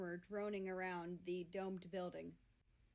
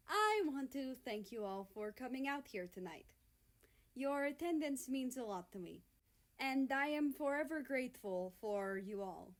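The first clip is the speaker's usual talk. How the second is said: formal speech; 4 semitones higher, 4 dB louder than usual. Acted. Skater addresses the arena.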